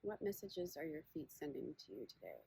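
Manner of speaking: unhurried meditation guide, long pauses between phrases; guided body scan. Acted. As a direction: guide listeners gently inward, leaving space after each question.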